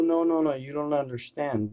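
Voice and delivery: usual speaking voice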